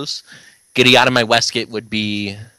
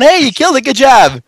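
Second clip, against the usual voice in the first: cheering; 12 semitones higher, 6 dB louder than usual. Retold or imitated. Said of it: "I was looking at you going".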